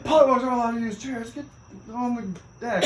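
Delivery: high, loud mock command; barked order. Hazers sending polywogs to the deck, high barked order. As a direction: bark it at the rookies, pitched high.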